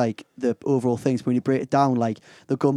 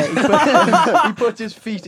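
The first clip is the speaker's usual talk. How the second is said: laughing; 9 semitones higher, 6 dB louder, slower than usual. Real, not acted. Cracking up mid-story.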